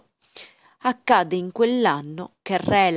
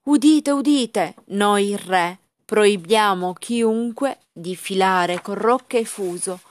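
loud, slow royal proclamation; town crier; 4 dB louder, slower than usual. Acted. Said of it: "rese pubblico un editto".

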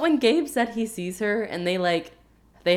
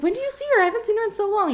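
way higher, mock wounded surprise; high incredulous Gwen; 11 semitones higher, faster than usual. Co-host imitating Gwen hearing about Aurora, high and surprised. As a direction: pitch it up like she can't believe it. Gwen voiced in retold scene.

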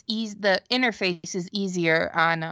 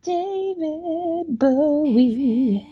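[name] impression; 7 semitones higher, much slower than usual. Bowie voice imitation game.

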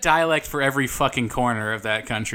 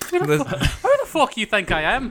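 high, indignant mock Irish; Irish accent; 10 semitones higher than usual. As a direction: put on an Irish brogue, pitched way up. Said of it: "so that's your accent".

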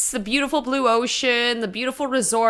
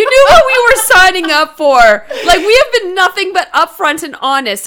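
laughing through the words, loud and high; 6 semitones higher, 8 dB louder than usual. Real, not acted. Host laughing hard while addressing listeners, louder and higher. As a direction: crack up while pleading with the audience.

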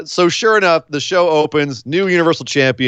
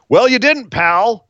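scornful retort; 6 semitones higher, slower than usual. Real, not acted. Mocking Goldberg's claim.

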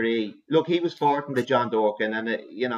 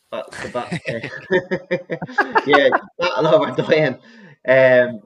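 laughing through the horse's name; breaking up mid-sentence, laughing; 3 semitones higher, 7 dB louder, much slower than usual. Real, not acted. Loses his thread, cracks up.

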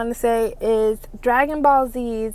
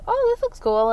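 excited; 13 semitones higher than usual. Retold or imitated. Recalls finding manga in store.

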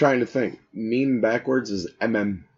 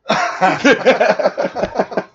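laughing; 10 semitones higher, 4 dB louder than usual. Real, not acted. Laughs at own prank.

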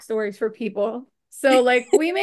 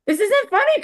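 mock indignant whine; 9 semitones higher, 5 dB louder than usual. Retelling complaint to Craig.

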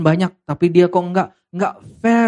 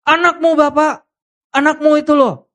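much higher, louder indignant outburst; angry elder son; 11 semitones higher, 4 dB louder than usual. Acted. Elder son angrily complaining to his father, shrill and loud. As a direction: spit out anakmu in resentment. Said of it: "waktu anak sulung ini marah".

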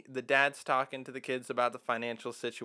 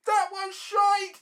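very high, slow, loud jeering yell; heckler's yell; 9 dB louder, much slower than usual. Retold or imitated. A guy in the crowd jeering the song, high mock yell. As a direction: bellow it like a drunk heckler. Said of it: "there's a guy who's like".

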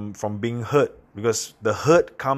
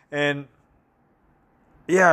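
ordinary talk, nothing marked

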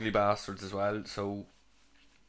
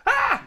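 short, high, loud "Ah!"; imitated scream of disgust; mock shriek; 9 dB louder than usual. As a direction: shriek as if appalled by the chat-up line. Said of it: "give the same reaction that they give".

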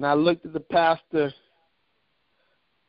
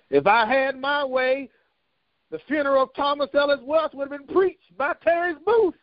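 higher preaching build; 10 semitones higher than usual. Real, not acted. Pastor telling church of Terrence Booth, preaching higher. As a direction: climb up as the sermon builds.

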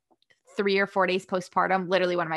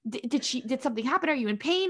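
higher-pitched string of anxious questions; mock concern, pitched up; imitating worried husband; 6 semitones higher, 3 dB quieter than usual. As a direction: play a fretting husband firing questions.

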